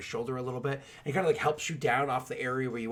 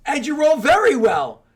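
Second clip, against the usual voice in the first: loud, high burst of excitement; real thrilled game master shout; excited announcement; 12 semitones higher, 13 dB louder than usual. Real, not acted. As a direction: cheer the player's lucky hit. Game master excited at lucky roll.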